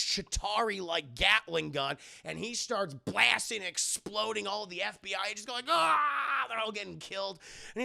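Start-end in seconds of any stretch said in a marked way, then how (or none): 5.7-6.5 high, loud mock scream; drawn-out cry of terror, acted; imitated scream. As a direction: yell like people under fire. Imitates agents being blasted.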